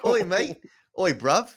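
a hint of cockney; light hammed-up British greeting; mock British accent. Co-host still joking, in a light British accent. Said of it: "Do an impression of him".

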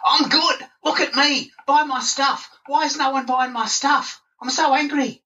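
high, whiny show-off; mock needy self-promoter. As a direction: beg for attention, up high. Mocking self-promoters on social media.

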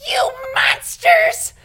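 very high, slow shout. Retold or imitated. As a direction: shriek it at the passing cars. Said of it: "just be like".

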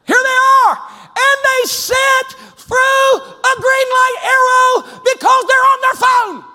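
high, loud, slow exasperated yelling; mock fuming rant. Preacher fuming at driver stuck on phone, loud exasperated rant. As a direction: drag every word out in outrage.

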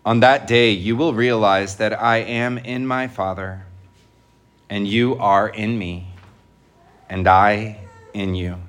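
measured, solemn liturgical reading; gospel proclamation. Real, not acted.

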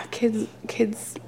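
soft exasperated whisper. Real, not acted. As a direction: hiss it under the breath.

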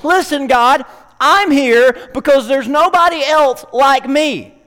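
loud, pleading cry to God in a slow, heavy voice; desperate plea. Acted. Elijah, fed up, pleading loudly to God. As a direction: cry out to God, begging to be heard.

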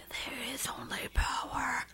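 eerie unvoiced whisper. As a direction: hiss it like a possessed mutter. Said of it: "mumbling to yourself".

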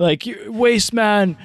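high, loud mocking troll voice; mimicking the troll who threatened him; mock London troll. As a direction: ham up a taunting bully.

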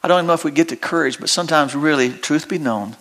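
usual speaking voice

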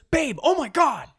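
high, overblown gasp for attention; mock dramatic wife. Wife calling husband over gossip, high mock drama. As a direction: play it like a disaster over trivia.

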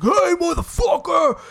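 hammed-up tough-guy yell, high and loud; mock aggressive shout. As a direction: pretend to charge at a drunk, yelling. Acts out aggressively confronting the drunk.

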